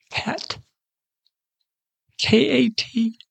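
hushed and tender, drawing out each letter; tender calling. Retold or imitated. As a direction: call her name gently, like a loving father. Lord tenderly calling her name.

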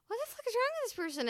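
fast, high shocked outburst; shocked quote. Retold or imitated. Therapist reacting to a bad influence, shocked and fast. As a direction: blurt it out in mock shock.

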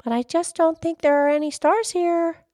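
high-pitched polite objection; high little-lass voice; very polite girl. Acted. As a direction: disagree courteously in a high child's voice. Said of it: "said as politely as she could".

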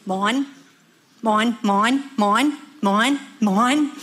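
squawky repeated calls, each flicking upward; insistent seagull chant with rising tune; Finding Nemo seagulls. Acted. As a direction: pester like a greedy gull, rising on every word. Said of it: "the seagulls".

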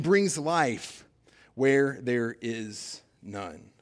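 drawn out, word by word; slow emphatic phrasing. Shown as a demonstration. Preacher slowing down to stress new life. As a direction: let each word land slowly.

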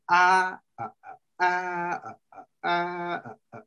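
rhythmic "ah" syllables, one long then two short; Homeric meter. Shown as a demonstration. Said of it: "that's the Homeric meter right there".

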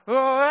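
loud high whoop of excitement; imitated proud parent's scream; excited yell. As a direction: let out a big high cheer. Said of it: "it's, it's this excitement".

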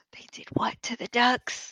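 appalled whisper; shocked whisper. Retold or imitated. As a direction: whisper it in horrified disbelief. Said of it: "saw the preview and was like".

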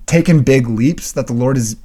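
ordinary talk

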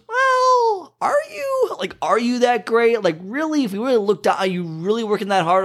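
high, mocking sing-song challenge; mocking skeptic. Podcaster mocking a bragging friend, high mocking voice. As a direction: poke holes in their bragging in a high mocking voice. Mocking people who brag.

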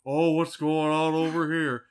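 half-hearted Cage impression, a little whiny; mild imitation of Nick Cage searching; Nick Cage whine. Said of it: "kinda whine".

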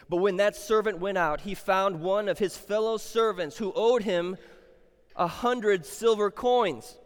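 builds loud and high into the demand; climbing to an angry demand; the story's fury swelling as the servant chokes him; rising intensity. Acted. Preacher retelling the choking scene.

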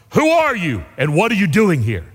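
forceful challenge from a guard; voice from darkness. Acted. Unseen voice challenging a wandering rabbi, acted stern demand. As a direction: demand it like a sentry challenging an intruder. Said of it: "this voice came from the darkness".

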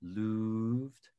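looved with a long oo, like "loo-ved"; looved said with a long oo vowel. Shown as a demonstration. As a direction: say looved with a drawn-out oo. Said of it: "with a long vowel sound".

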